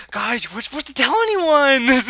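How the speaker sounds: high, loud, slow mock outcry; hammed-up high voice, imitating an absent friend; mock panicked Terry. Host imagines Terry giving away a secret, mock outcry. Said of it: "but in a British accent".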